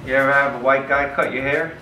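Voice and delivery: ordinary talk, nothing marked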